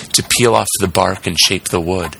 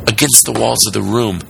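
plain reading voice

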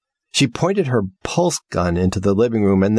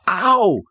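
high yelp of pain; 12 semitones higher than usual. Acted. House hurt by breaker.

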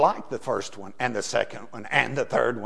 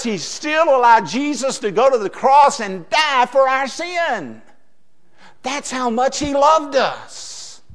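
higher, louder preacher's climax slowing at the end; preaching at full force; 6 semitones higher, 5 dB louder than usual. Acted. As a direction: drive it home with awe.

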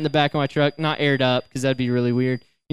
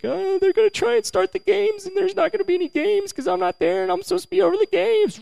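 much higher, frantic worried rush; mock panic. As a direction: play up the panic in a high voice. Panicking about being late.

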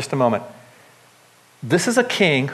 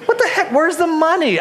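incredulous mock outrage; 11 semitones higher than usual. Preacher scoffing at the servant who blew a million. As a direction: needle him like you can't believe it.

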